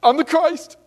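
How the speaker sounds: high, loud hammed-up self-proclaiming; mock boasting. Preacher mocks a boastful Christ, high and loud. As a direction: puff yourself up, overplayed. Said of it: "go around saying".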